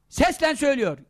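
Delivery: ordinary talk, nothing marked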